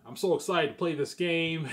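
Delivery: put-on excited southern boy; southern gamer impression. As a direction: imitate an excited southern streamer, loud and high. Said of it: "Not bad impression".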